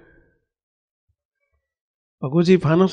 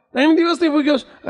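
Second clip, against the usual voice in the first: concerned question; 10 semitones higher than usual. Acted. Maharaj asks the boy.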